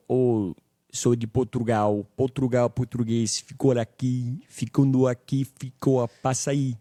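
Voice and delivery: a mock Portugal Portuguese accent, teasing; European Portuguese. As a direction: parody how people from Portugal sound. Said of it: "Portuguese from Portugal sounds like shit".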